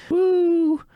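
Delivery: long, high-pitched "woo". Real, not acted. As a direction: let out a drawn-out high whoop. Exclamation after laughing about canoodling.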